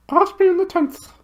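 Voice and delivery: very high hooting owl voice, far from the narrator's own; high, hooting bird voice. Acted. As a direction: hoot it like a big owl.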